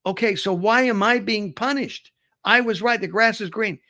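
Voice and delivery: high, insistent mock indignation; aggrieved protest. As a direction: plead your case as the wronged party. Punished tiger argues with the lion.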